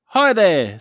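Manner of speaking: loud, high friendly little hot dog; hot dog's hello. A narrator's reading. Living hot dog greeting Petey, high and loud. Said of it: "says the hot dog".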